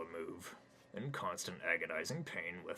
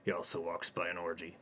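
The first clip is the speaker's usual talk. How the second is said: ordinary delivery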